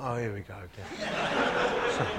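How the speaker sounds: slow, laughing resignation; resigned laugh. Retold or imitated. Thinking as the man admires his coat, slow resigned laugh. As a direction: chuckle wearily at the inevitable.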